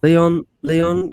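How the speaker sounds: loud call for attention. Real, not acted. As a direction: call his name loudly. Calling out to Leon.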